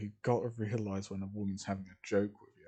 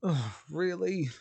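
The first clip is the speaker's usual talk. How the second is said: exasperated, higher, drawn-out groan; loud sigh; 6 semitones higher, 4 dB louder than usual. Acted. Belle finds Rachel in his own bed, loud sighing groan. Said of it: "let out a very loud sigh".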